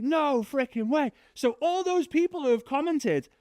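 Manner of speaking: dad in high disbelief; amazed dad. Retold or imitated.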